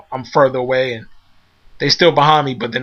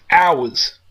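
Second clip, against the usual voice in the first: ordinary delivery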